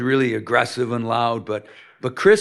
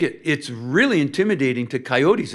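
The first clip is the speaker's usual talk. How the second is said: normal talking voice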